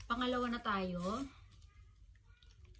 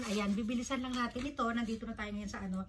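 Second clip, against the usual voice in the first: ordinary talk, nothing marked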